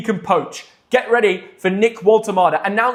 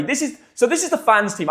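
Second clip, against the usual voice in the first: ordinary talk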